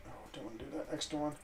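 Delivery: ordinary talk, nothing marked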